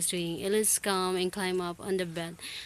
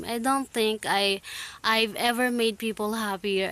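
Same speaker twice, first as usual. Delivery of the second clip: plain reading voice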